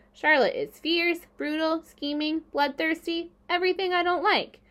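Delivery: high-pitched fretting; worried pig tallying faults; dismayed listing. A narrator's reading. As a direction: hold it high, item by item. Pig fretting over bloodthirsty friend.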